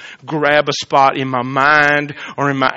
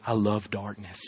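hushed confession; 6 semitones lower, 11 dB quieter than usual. Retold or imitated. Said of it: "I would have said".

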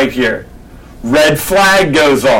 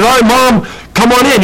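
higher, calling out to mom offstage; 7 semitones higher than usual. Acted. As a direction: summon mom onto the stage. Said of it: "He says".

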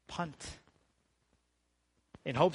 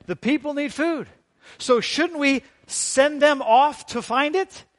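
loud, high-pitched urgent appeal; urgent disciples; 11 semitones higher, 12 dB louder than usual. Retold or imitated. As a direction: voice the disciples pleading their case, raised and insistent. Voicing disciples questioning Jesus.

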